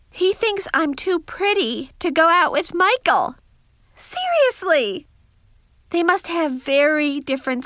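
put-on astonished teen diarist, pitch leaping up; incredulous. A narrator's reading. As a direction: marvel at how absurd it is. Narrator incredulous at being called pretty.

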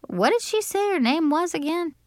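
put-on high-pitched puzzled girl; puzzled fairy godmother. A narrator's reading. Fairy godmother puzzled about name.

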